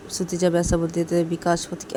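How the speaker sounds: usual speaking voice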